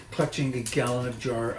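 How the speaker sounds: ordinary delivery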